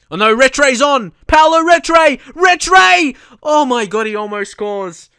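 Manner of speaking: high, loud match-commentary excitement; excited yelling. Real, not acted. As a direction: yell at the screen as chances come. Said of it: "I just reacted so badly".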